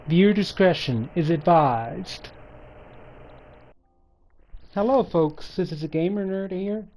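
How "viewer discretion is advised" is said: loud content-warning announcement; announcer disclaimer. Acted. Gamer warns viewers about graphic content, loud announcer read. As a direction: announce it loudly, like an official disclaimer.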